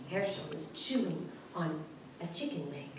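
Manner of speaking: plain reading voice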